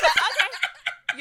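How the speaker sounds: very high loud laughing; laughing okay. Retold or imitated. Conceding to friend, loud high laughing. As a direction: shriek okay through laughter. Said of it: "I was like".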